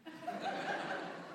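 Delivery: a high, airy burst of laughter. Retold or imitated. Kid laughing at the lady spelling out crap. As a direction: laugh in disbelief.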